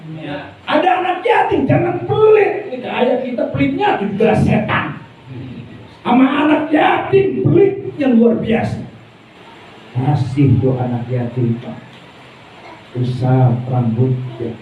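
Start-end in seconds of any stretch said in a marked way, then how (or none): 0.7-8.8 fiery preaching. Acted. Preaching at full force.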